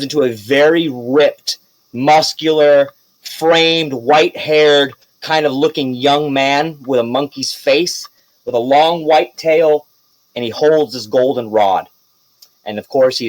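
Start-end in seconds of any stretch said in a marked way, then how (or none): none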